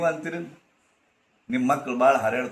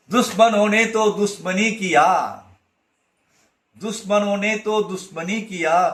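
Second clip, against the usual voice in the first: raised, declaiming a Hindi couplet; recited Hindi verse; 4 semitones higher, 4 dB louder than usual. Acted. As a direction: proclaim the verse, loud and higher. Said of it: "Khaleel Gibran ... ಬರ್ದಾನ".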